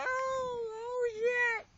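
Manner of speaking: high, loud cry of alarm; panicked scream. Real, not acted. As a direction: shriek it out in fright. Scream while spinning.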